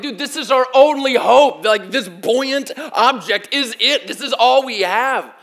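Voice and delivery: loud, desperate cry for help; a panicked disciple yelling that this is all they have; panicked shout. Retold or imitated. Disciples yelling in panic as the boat sinks.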